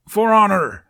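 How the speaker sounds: high, very loud war cry; battle cry. Acted. Toughnut charging into the joust, battle cry. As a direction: charge with a yell.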